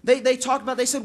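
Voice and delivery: usual speaking voice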